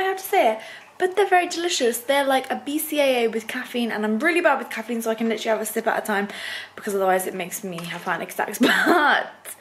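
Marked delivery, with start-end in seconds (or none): none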